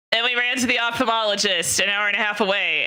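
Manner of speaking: normal talking voice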